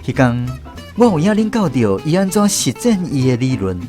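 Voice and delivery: ordinary talk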